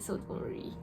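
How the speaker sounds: breathy whispered aside. Real, not acted. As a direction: drop to a whisper. Aside after separate bed remark.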